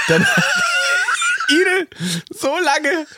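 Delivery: hoch und lachend durch die Worte; lachend erzählt. Real, not acted. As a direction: kann sich beim Erzählen kaum halten. Erzählt lachend die Kellner-Geschichte. (lachend erzählt, Stimme hoch)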